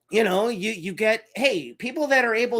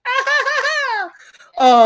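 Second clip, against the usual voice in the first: slow, very high crazy cackle, ha, ha, ha; hammed-up villain laugh; mad cackle; 10 dB louder, slower than usual. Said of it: "So I'm always like".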